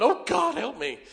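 a high, loud panicked cry for help; mock panic. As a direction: squeal it like a scared tough guy. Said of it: "you're going".

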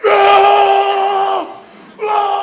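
high, loud ecstatic cry; hammed-up joyful yell; joyful shout. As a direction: shout for joy. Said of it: "He said he was like".

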